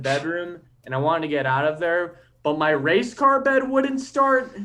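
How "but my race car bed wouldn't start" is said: high, loud, slow punchline; hammed-up whine at a toy bed; mock frustration. Comic to the crowd, a hammed-up whine about a bed that won't start.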